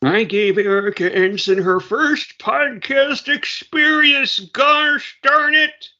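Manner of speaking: high, slow mock lament. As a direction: ham up the regret. Mock grievance, exaggerated self-blame.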